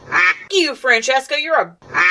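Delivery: high-pitched mock indignation; mock angry outburst. Viewer telling off a disliked character, high mock outrage.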